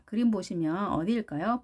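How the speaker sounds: normal talking voice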